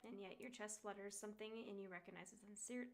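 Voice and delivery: soft, even second-person narration; game story narration. Acted. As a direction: read it like a visual novel narrator. Reads game narration aloud.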